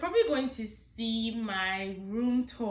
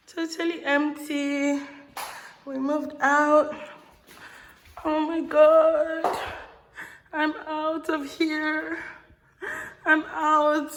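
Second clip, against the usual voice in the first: excited calls ringing round bare walls; big hall echo; 7 semitones higher, slower than usual. Real, not acted. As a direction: voice echoing in an empty room. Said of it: "Sorry if I'm echoing".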